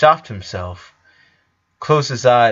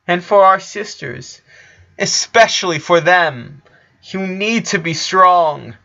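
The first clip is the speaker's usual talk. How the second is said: tearful plea; 4 semitones higher than usual. A narrator's reading. Lincoln begging sister to be strong.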